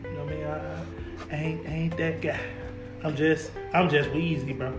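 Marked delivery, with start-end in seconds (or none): none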